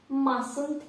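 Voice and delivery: mustn't said as masent with no t; silent t. Shown as a demonstration. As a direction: drop the t in mustn't. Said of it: "тут буква Т не читається".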